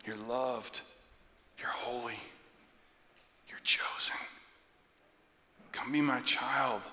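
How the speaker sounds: hushed, gentle, unhurried reassurance; God's whisper. Acted. God reassuring a beloved child, tender hush. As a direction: whisper each truth with a pause after. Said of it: "God whispers to us instead".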